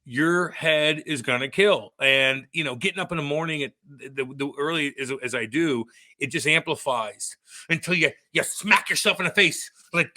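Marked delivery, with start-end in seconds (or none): none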